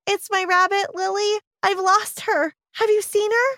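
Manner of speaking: put-on a four-year-old asking about her lost rabbit; little girl voice. A narrator's reading.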